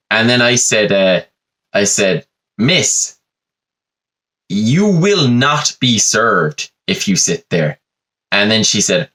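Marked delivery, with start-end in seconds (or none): 2.6-7.8 slow, deliberate "you will not be served"; a waiter coldly refusing a rude customer, slowly; firm refusal. Retold or imitated. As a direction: lay down the law word by word.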